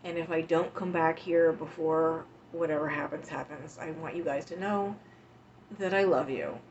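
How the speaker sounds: normal talking voice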